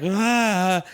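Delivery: high mock wail of a crying kid. Pessimist kid wailing at his new bike, mock crying. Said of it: "this pessimist child starts crying".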